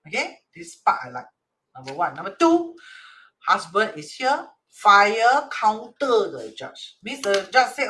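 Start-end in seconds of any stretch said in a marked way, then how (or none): none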